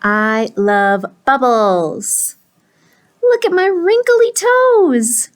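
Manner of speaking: slow gleeful cry, shooting up high; delighted pigeon. Acted. Pigeon loving his bubble bath, drawn-out high delight. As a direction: revel in it, stretching every word.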